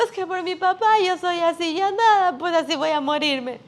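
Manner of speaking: preacher mocking a grown-up's excuses, whiny; mock whining excuse. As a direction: parody someone hiding behind their father. Mocking people who blame parents.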